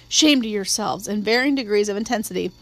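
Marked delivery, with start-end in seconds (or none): none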